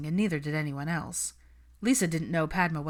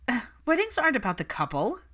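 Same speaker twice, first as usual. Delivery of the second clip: higher, dismissive flat statement; matter-of-fact; 7 semitones higher, 3 dB louder than usual. Acted. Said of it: "in a matter-of-fact tone".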